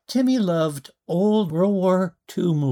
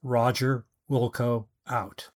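flat, lower radio call; monotone radio voice; 5 semitones lower, 4 dB quieter than usual. Acted. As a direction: recite it like a bored radio operator. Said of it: "Timmy's call code was".

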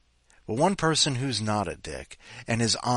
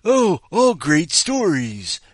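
gushing commenter; 7 semitones higher, 9 dB louder, slower than usual. Retold or imitated. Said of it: "people said".

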